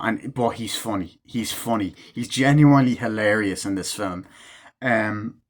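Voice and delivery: ordinary talk, nothing marked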